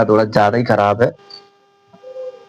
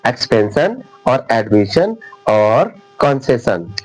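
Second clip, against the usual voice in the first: the three words said slowly and deliberately; slow recap; 3 semitones lower, slower than usual. Shown as a demonstration. Teacher recaps exceptions for students, slow and clear. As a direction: say expansion, admission, concession slowly for memory. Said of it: "तीन बार अपवाद के तौर पर याद रखिएगा".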